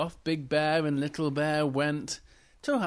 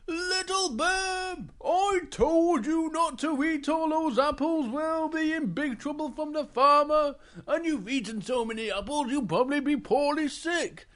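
scolding Big Bear; 12 semitones higher than usual. Acted. Big Bear catches Little Bear eating apples, scolding. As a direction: scold like an exasperated storybook bear.

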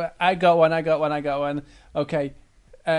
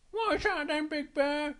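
high, softer cub voice; Little Bear; 9 semitones higher, 6 dB quieter than usual. Acted. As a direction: ask like a curious little bear. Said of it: "Big Bear says to Little Bear".

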